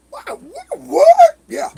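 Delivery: sudden high, loud what; incredulous outburst. Retold or imitated. Man retelling his disbelief at neighbors parking on his land, high loud outburst.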